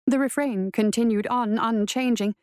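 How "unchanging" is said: usual speaking voice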